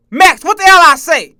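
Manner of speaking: put-on loud, high parent yelling at a kid; mock yelling parent. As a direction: bellow at the kid like a fed-up parent. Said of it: "They be like".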